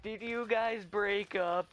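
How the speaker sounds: high, loud, slow mock concern; joking teasing. Teasing two sad friends at lunch, joking teasing. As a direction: tease them with an exaggerated question. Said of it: "I was just joking".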